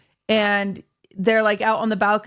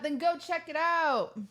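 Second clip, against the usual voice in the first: high urging; 7 semitones higher, 9 dB quieter than usual. Real, not acted. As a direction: go pitched up high, pushy. Urging listeners to watch.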